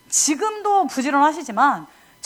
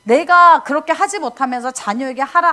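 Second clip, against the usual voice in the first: normal talking voice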